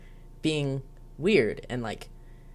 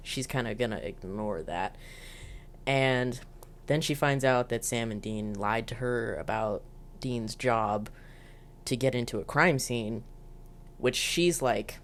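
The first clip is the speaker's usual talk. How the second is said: usual speaking voice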